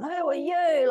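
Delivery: high, astonished who are you; imitating amazed clubbers. Clubbers staring at her leather garb, high and amazed. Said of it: "like this".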